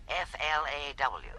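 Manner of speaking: patiently spelling F L A W letter by letter; spelled out. Shown as a demonstration. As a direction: spell out F L A W one letter at a time, slowly and quietly. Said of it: "But that's flaw".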